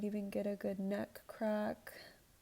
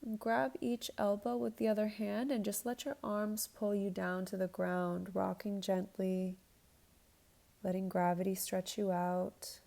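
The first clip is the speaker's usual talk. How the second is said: yoga instructor cueing. Acted. Leads a yoga flow.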